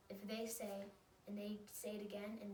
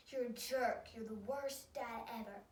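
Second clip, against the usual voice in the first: loud mock outburst at dad; super mean; 6 dB louder than usual. Shown as a demonstration. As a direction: hurl it as a loud, pretend insult. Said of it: "super mean to somebody".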